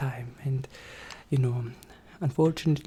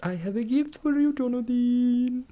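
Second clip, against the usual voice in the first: sing-song; 9 semitones higher, 3 dB louder than usual. Acted. Sing-song high gift reveal.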